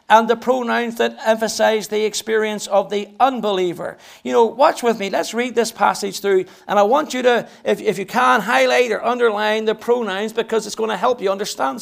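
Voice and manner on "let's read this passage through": ordinary talk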